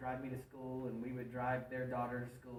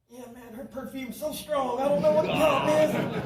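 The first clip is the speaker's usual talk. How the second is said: a proud man hiding that he's crying; choked-up excuse; 10 semitones higher, 16 dB louder than usual. Retold or imitated. Granddad blames the perfume for his tears, high and strained.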